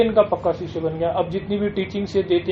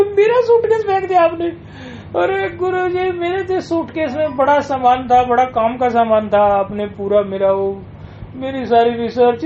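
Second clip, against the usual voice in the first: distraught complaint; 6 semitones higher than usual. Retold or imitated. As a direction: pour out the loss to the guru. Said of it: "बहुत गुस्से में आ गया".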